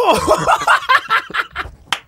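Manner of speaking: high, loud cackling burst; loud laughing fit. Real, not acted. Friend watching a disgusting bite, loud laughing fit.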